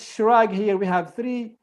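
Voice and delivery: ordinary delivery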